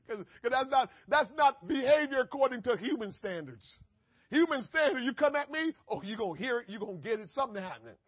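loud and high, preaching hard; fiery preaching. Acted. Preacher to his congregation, loud fired-up preaching. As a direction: drive it home to the congregation.

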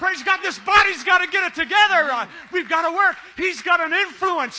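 loud building preacher exhortation; preaching at full force. Acted. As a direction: drive it home, rising.

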